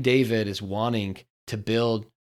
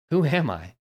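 higher, pointed challenge; sharp questioning who am I; demanding tone; 4 semitones higher than usual. Shown as a demonstration. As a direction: throw the question out defiantly. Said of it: "Do you hear the tone difference?".

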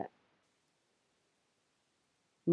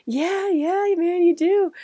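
higher, louder, humouring his son; amused dad; 11 semitones higher, 5 dB louder, slower than usual. Retold or imitated. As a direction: reassure the high son, grinning. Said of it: "dad taking the video".

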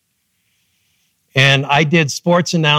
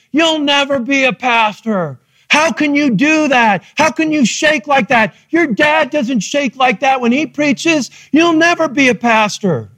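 higher, mocking schoolkid voices; kids taunting; 7 semitones higher than usual. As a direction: mimic the kids jeering at him. Schoolkids taunting him at recess.

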